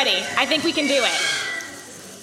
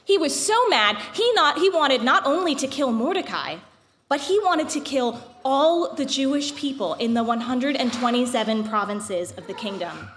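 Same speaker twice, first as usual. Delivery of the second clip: epic fantasy narrator; 2 semitones higher than usual. A narrator's reading. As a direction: tell it like an old legend. Reads the Purim story aloud.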